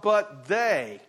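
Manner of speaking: "they" loud and drawn out; stress on THEY. Shown as a demonstration. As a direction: lean hard on the word "they". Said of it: "emphatic word".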